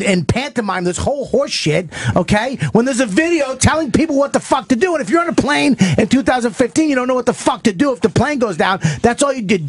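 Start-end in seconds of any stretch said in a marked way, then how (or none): none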